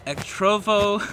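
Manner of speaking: ordinary delivery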